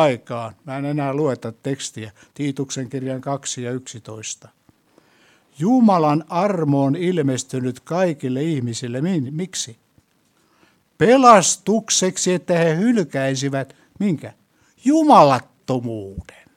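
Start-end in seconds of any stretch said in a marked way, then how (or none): 5.6-16.5 preaching at full force. Acted. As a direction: hammer the verse home. Proclaims Titus verse.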